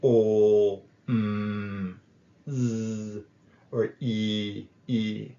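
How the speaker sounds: "o, m, z, e" each held and buzzing; voiced sounds. Shown as a demonstration. Teacher lets students feel vibration on held voiced sounds. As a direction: stretch each sound so the throat hums. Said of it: "Same thing for r".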